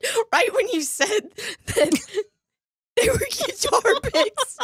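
high, squeaky laughing speech; cracking up, words squeezed high; real helpless laughter. Real, not acted. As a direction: barely get it out through laughing. Cracking up while explaining the joke.

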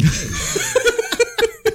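squealing high laugh. Real, not acted. Laughing at joke.

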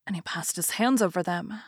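usual speaking voice

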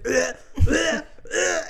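mimicked retching, in repeated bursts; imitated dry heaving. Mother imitating dad at first poop diaper, dry heaving. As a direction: act out gagging noises. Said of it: "like, dry heaving".

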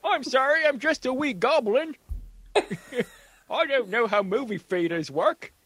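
a wee Cockney goblin, apologetic and silly. Acted. Answering his cohost as the goblin, high Cockney accent. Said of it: "good Cockney accent?".

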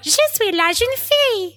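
put-on high, girlish French recitation; high-pitched ad-girl French; commercial girl impression. Quoting an old language-tape commercial.

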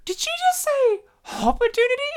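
very high-pitched incredulous question; mock disbelief. Asking his girlfriend while watching this, high mock disbelief. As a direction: squeak it out, astonished by what was heard.

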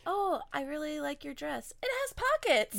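high, bright mock gushing; gushing compliment. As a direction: gush like a fan. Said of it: "It's like".

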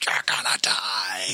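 slow, raspy whispered threat; drawn-out haunted-house whisper; creepy threat. Retold or imitated. Unseen maze ghoul menacing people rounding the corner, creepy threat.

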